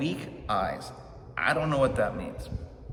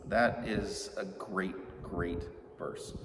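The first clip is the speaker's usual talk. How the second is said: slow and much softer, savoring it; tender; 4 semitones lower, 7 dB quieter, much slower than usual. Real, not acted. Pastor savoring a love verse to his congregation, softly and slowly. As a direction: linger on it, hushed and tender.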